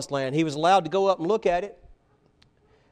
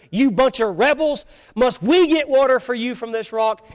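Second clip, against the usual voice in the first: higher and louder, furious outcry; cried out in anger; 6 semitones higher, 5 dB louder than usual. Retold or imitated. Moses crying out at the people, angry and loud. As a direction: bark it at the crowd in anger. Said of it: "he just cried out in anger".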